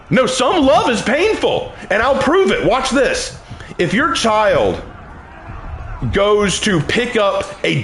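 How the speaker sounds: high, insistent rebuttal from the pulpit; emphatic preaching. Real, not acted. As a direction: argue it forcefully like a preacher.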